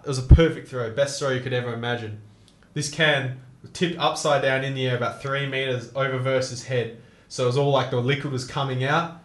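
normal talking voice

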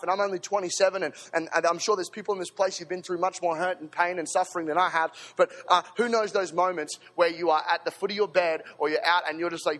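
usual speaking voice

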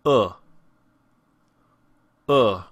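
short "uh" as in book; horseshoe vowel. Shown as a demonstration. Teacher models the horseshoe symbol, short isolated "uh" as in book. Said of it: "and the sound is".

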